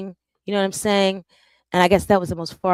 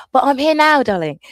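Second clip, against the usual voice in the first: higher and louder, a showy "here I am"; playful loud declaration; 7 semitones higher, 7 dB louder than usual. Real, not acted. Telling her overseas viewers she showed up anyway, loud and playful. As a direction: announce yourself with a teasing flourish.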